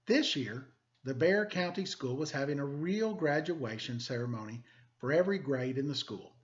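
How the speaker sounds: lively, expressive picture-book reading; storybook narration for students; reading to children. Acted. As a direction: read it like story time for the class. Teacher reads picture book aloud.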